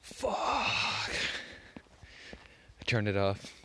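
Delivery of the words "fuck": breathy high whisper of a curse, just woken up; groggy whispered curse. Retold or imitated. Retelling the alarm going off, a whispered groggy curse. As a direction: hiss it out, half asleep. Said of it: "I was like".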